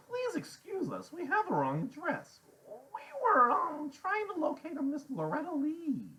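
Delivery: very high, formal little voice; Freak's voice. A narrator's reading. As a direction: excuse yourself politely in a squeaky-high voice. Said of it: "his voice is chattery high".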